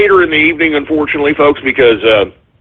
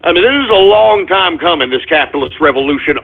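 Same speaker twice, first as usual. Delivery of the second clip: ordinary talk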